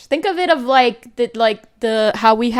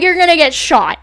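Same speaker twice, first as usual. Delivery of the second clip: raised, incredulous pushback at mom; louder and higher, telling her she's being absurd; retelling an argument with mom, incredulous and loud; exasperated retort; 7 semitones higher, 7 dB louder than usual. Retold or imitated.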